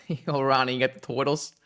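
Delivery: mock New Yorker saying "toidles"; New York accent. New Yorker asking Ronnie for turtles, broad put-on accent. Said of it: "in a New York accent".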